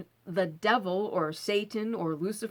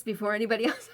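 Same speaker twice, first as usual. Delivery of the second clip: usual speaking voice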